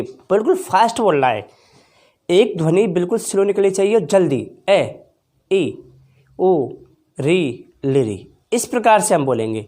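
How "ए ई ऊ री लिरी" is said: each vowel clipped quick and light; quick short vowels. Shown as a demonstration. Teacher showing short vowels, said quickly. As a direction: say each vowel fast, one beat. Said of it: "तो देखिए कैसे बोलेंगे".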